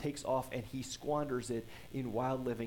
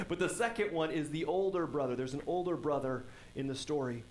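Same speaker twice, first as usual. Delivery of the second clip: ordinary talk, nothing marked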